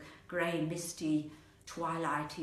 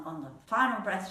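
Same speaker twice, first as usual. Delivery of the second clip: plain reading voice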